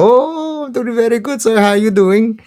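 very high-pitched, put-on Indian accent; mock Indian accent. Student mimicking the teacher to classmates, high Indian accent. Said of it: "he starts talking in that accent".

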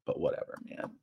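unvoiced, soft aside; whispered aside. Real, not acted. Vlogger dismissing the holiday pairing in a whisper. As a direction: mutter it under your breath. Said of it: "dismissive aside".

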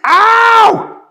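high loud "Ow!"; yelp of pain. Acted. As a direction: yelp as a hot plate burns you. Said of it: "and yelled".

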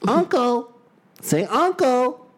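very high, squawky parrot. Pretend. Imagining the coaxed parrot, squawking high. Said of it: "How do you bully a parrot?".

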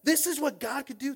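high, loud amazed cry; retold ecstatic outburst; fired up out of mind. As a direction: exclaim it with wild excitement. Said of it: "fired up out of my mind going".

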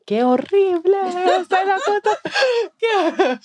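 high, laughing cry of horror; mock disgusted shriek. Mock shriek on meeting the handsome announcer.

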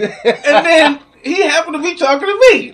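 high, loud laughing delivery. Real, not acted. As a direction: laugh through the punchline. Laughing during the punchline.

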